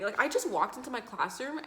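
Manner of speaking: ordinary delivery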